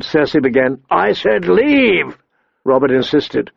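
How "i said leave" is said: fierce. A narrator's reading. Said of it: "with a hint of his old fierceness".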